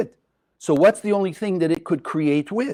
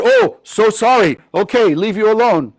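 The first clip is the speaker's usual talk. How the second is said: hammed-up brush-off, higher and louder; mock apology; 4 semitones higher, 4 dB louder than usual. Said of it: "And then they went".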